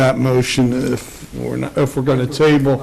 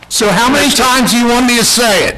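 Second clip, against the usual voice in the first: fed up, voice pitched up high; exasperated raised voice; 8 semitones higher than usual. Real, not acted. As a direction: snap back, clearly losing patience. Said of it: "I would appreciate if you would do so as well".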